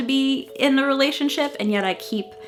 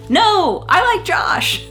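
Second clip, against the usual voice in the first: emphatic; 10 semitones higher, 6 dB louder, much slower than usual. Retold or imitated. Reading dramatic diary line.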